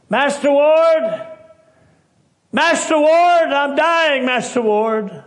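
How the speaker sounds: high, slow, drawn-out loud calls; desperate holler. Retold or imitated. Dying soldier hollering for Master Ward from his bunk. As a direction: cry out for help from a sickbed. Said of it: "began to holler out".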